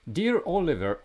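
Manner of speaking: high-pitched Russian babushka voice; Russian accent. Retold or imitated. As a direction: read it like a Russian grandma. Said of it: "I will read it for you in a Russian accent".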